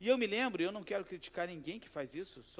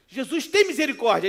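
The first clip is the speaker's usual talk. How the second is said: raised, loud mock plea; retold plea; 6 semitones higher, 14 dB louder than usual. Slapped victim changing his prayer, loud re-enacted plea. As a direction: call out for mercy, played for comedy. Prayer turns to begging after slap.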